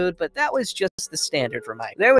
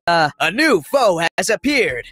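big game-announcer voice; 4 dB louder than usual. Acted. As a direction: announce it like a video game challenge screen. Reads game's on-screen announcement.

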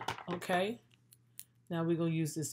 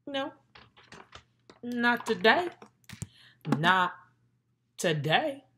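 loud refusal; 7 semitones higher, 9 dB louder, slower than usual. Real, not acted. Refusing strangers who holler at her.

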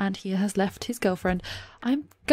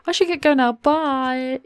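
higher, louder, hurried-off farewell; acted awkward exit; 5 semitones higher, 4 dB louder than usual. Sim fleeing an awkward encounter, brightly awkward. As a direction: make an awkward excuse to leave.

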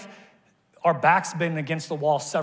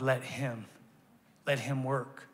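gentle plea; 3 semitones lower, 8 dB quieter, slower than usual. Real, not acted. Quiet pastoral appeal.

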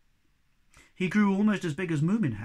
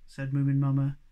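plain reading voice

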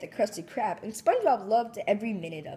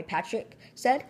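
plain reading voice

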